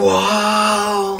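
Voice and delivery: ordinary delivery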